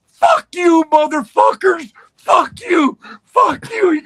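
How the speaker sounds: high, loud enraged shouting; furious yelling. Retold or imitated. Rip leaps up, yelling at Vince and Pat, furious. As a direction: explode at them with fury. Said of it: "Rip jumped to his feet and he said".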